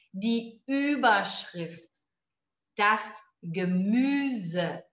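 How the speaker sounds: slow, clear model words for repetition; slow drill. Shown as a demonstration. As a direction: read each word slowly for students to copy. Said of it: "vamos treinar essas palavras".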